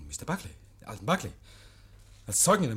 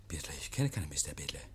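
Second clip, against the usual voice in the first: geflüstert; 9 semitones lower, 6 dB quieter than usual. Acted. Oak weicht der Frage aus.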